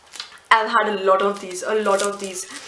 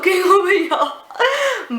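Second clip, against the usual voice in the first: laughing; 6 semitones higher, much slower than usual. Real, not acted. Laughs about favorite candy.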